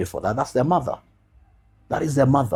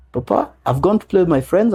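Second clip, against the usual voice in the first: put-on higher, lively little girl voice; imitating his daughter, pitched up; daughter's voice; 8 semitones higher than usual. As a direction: play the chatty daughter. Father voicing his daughter.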